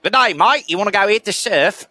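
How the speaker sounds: teasing how every Australian sounds, mock Australian accent. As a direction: lightly ham a surfer Australian accent. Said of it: "a version of an Australian accent".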